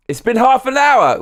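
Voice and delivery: exaggerated high moan of dismay; mock dismay. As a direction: groan at the long stream ahead, hammed up. Mock despair at time.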